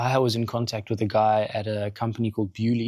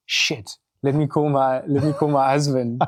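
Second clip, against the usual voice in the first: alarmed; 4 semitones higher, 4 dB louder than usual. Retold or imitated. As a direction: blurt it out in a panic. Julie reacts in alarm.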